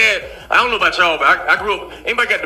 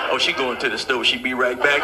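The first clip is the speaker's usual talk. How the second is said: lightly voiced low dad; 8 semitones lower, 5 dB quieter than usual. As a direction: drop low and deadpan.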